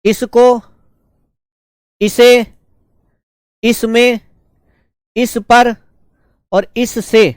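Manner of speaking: slow, spaced dictation with a pause after each word. Acted. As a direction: call out each word clearly so the students can write it. Dictates word forms to students.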